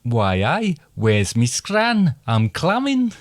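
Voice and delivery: slow Geordie accent, high at the end; a broad Geordie accent. Shown as a demonstration. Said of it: "come down the country".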